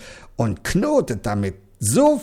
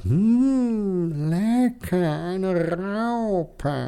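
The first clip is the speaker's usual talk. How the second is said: higher, savouring owl voice; hungry owl; 7 semitones higher, much slower than usual. Acted. The owl eyes the caterpillar hungrily, a higher savouring voice. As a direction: relish the snack. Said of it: "und sagt".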